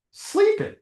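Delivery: high, mocking squeak on the word; a quick high jab at lazy kids; mock sleeping. Mocking thirty-year-olds who sleep all day, high and scornful.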